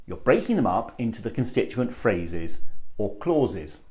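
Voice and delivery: ordinary delivery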